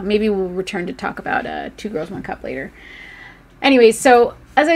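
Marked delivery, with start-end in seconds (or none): none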